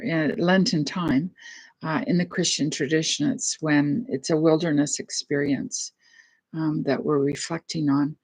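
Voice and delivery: ordinary talk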